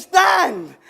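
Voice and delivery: ordinary talk, nothing marked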